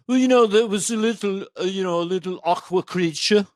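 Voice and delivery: put-on lilting Beatle's Liverpool accent; [name]. Retelling a stingray news story, in Beatle accent. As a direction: put on a Beatle accent, tune lifting as if asking. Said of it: "as a Beatle would say it".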